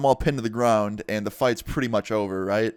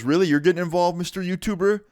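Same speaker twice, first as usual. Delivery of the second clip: high, mocking imitation of a rival; mocking taunt; 8 semitones higher than usual. Emo kid taunting the YouTuber, mock-incredulous. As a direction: needle him with a raised, mocking voice.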